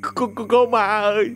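frightened stammer. Acted. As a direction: beg her to stop scaring you, stumbling over words. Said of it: "sợ hãi run run ... lắp bắp". (lắp bắp sợ hãi, giọng cao)

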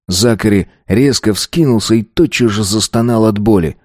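plain reading voice

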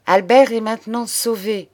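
proudly. A narrator's reading. Claire announces Albert is saved, proudly. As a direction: declare it with pride. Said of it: "dit-elle fièrement".

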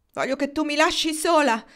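wife shouting at her husband to go. A narrator's reading. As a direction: yell at him to leave. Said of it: "gridò lei".

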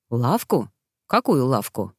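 low-voiced puzzled husband; surprised. A narrator's reading. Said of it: "удивлённо спросил муж". (удивлённо, низким голосом)

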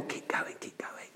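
barely audible soft whisper; hushed mock-tender whisper; tender, gentle. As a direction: whisper it like a gentle, timid encouragement. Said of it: "it's not a tender, gentle".

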